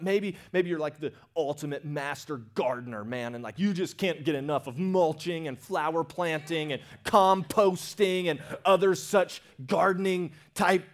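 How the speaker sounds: usual speaking voice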